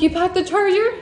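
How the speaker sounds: can't believe it; squeaky-high incredulous retold question; astonished. Disbelief at the packed charger.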